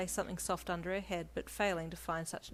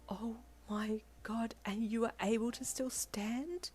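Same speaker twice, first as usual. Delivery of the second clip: slow, high, breathy amazement; wide-eyed awe; 4 semitones higher, slower than usual. A narrator's reading. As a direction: draw it out in hushed disbelief. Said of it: "asked with wide eyes".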